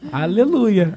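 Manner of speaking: mock praise, swooping up in pitch; meek mock agreement. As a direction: agree with comic church fervour. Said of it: "e eu só concordo".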